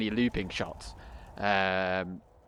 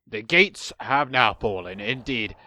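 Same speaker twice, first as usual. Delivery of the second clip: dwarf lord voice; 3 semitones lower than usual. Retold or imitated. Echoing the game's dwarf lord.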